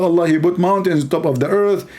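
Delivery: ordinary delivery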